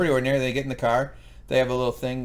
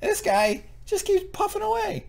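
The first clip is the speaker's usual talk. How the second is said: much higher, outraged squeak; high-pitched indignant. Real, not acted. Mock indignation at smoker.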